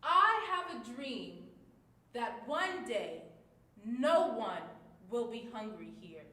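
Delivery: loud, slow and full of hope; hopeful and upbeat. Shown as a demonstration. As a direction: lift the audience with every word. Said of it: "read our speech to you using all of our different emotions".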